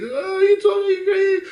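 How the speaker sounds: high squealing mock wail of a young woman; mock crying girl. Crying girls' reaction retold.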